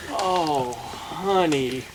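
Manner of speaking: ordinary talk, nothing marked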